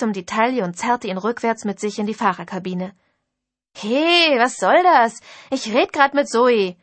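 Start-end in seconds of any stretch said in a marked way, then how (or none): 3.7-6.8 loud, higher indignant yell; protesting shout. Acted. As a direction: shout back while being dragged away. Heath dragged off, protesting. (lauter, empörter Protest)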